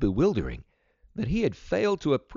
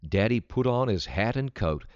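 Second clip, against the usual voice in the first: ordinary delivery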